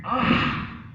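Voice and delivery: loud, high breathy "Ah!"; a fallen little boy's cry of pain; child's cry. Acted. Little boy falls on the mountain, crying out. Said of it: "fell down and then cried".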